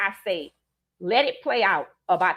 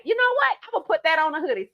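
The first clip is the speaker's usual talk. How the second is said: excited idea; 6 semitones higher, faster than usual. Retold or imitated. Retelling her own reply.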